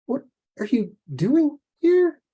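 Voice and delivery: very high, slow, halting question; shocked question. Acted. Woman asking why the giant bird came, shocked. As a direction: force it out slowly, rising high in disbelief.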